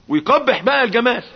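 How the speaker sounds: loud, high preaching cry; rising sermon shout. Acted. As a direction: drive the point home with force.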